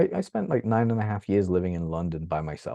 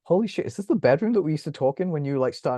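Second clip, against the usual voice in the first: high, excited holy shit from a friend; imitating friend's amazement; 9 semitones higher, 3 dB louder than usual. Friend recognising his old bedroom, high amazed voice. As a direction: play the stunned old friend.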